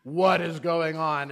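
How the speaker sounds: loud, slow, punched-out exclamation; retold panicked outburst. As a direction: imitate a suddenly woken child's alarm. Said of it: "she went from zero to".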